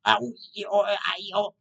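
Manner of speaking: high yelps of a rolling biker; mock pain cries. Acting out the crashed biker rolling on the road, mock yelps of pain. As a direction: yelp like you're tumbling on asphalt.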